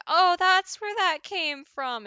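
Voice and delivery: loud, high, drawn-out "oh"; mock realization. Streamer imagining viewers finding the memes, mock realization. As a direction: act out everyone suddenly getting it. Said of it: "everyone's gonna be like".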